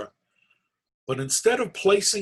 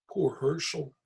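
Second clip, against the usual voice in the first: ordinary delivery, nothing marked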